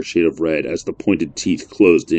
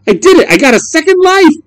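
put-on high, loud joyful kid; triumphant shout; 7 dB louder than usual. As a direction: shout with victory. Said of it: "shouted Adam".

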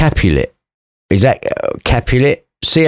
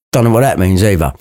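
ordinary delivery

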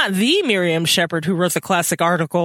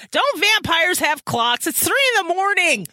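emphatic indignant; 8 semitones higher than usual. Real, not acted. Voicing Sarah's complaint, agreeing.

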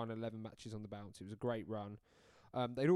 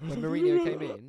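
high, loud excited build-up; 13 semitones higher, 12 dB louder than usual. Real, not acted. Co-host hyping Mourinho's arrival, excited. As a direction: burst out with it.

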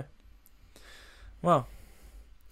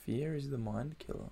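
very soft, under the breath; hushed quote; 14 dB quieter than usual. Acted. Co-host repeating the Dune quote to himself, hushed. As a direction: echo it quietly, as if pondering it. Echoes film quote in mock reverence.

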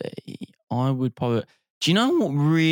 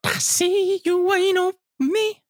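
[name] impression. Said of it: "I won't do the accent".

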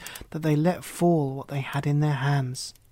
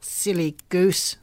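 slow, louder mocking old witch; slow louder witch scoff; scornful witch; 3 semitones higher, 4 dB louder, slower than usual. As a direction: sneer at her slowness. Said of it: "said the old woman".

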